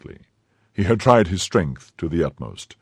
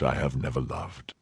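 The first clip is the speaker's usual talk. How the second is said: quiet confession; 5 semitones lower, 8 dB quieter than usual. Acted. Athos confesses softly.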